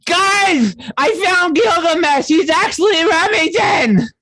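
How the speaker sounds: high, squeaky voice calling out; excited call. Acted. Maeve calling out from the captain's quarters, high squeaky excitement. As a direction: burst in shouting the big news.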